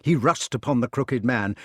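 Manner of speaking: ordinary delivery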